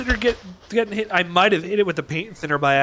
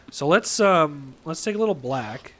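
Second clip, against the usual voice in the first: usual speaking voice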